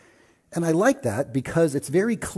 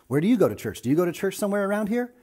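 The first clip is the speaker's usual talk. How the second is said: friendly lady asking; 8 semitones higher, faster than usual. Retold or imitated. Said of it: "she's like".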